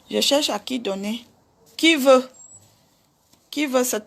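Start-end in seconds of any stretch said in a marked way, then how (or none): 1.7-2.3 louder call-out to the viewers. Real, not acted. Offering her wig to the viewers, called out loud. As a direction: call it out to the live audience.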